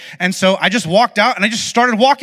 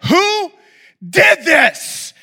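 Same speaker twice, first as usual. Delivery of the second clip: demon voice; 11 semitones higher, much slower than usual. Retold or imitated. Pastor voicing the angry friend's mom, demon shriek. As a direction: play the mom gone demonic with rage. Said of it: "she had a demon voice".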